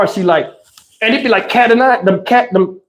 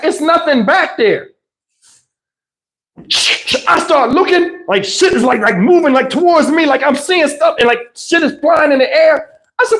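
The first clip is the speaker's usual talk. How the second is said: ordinary delivery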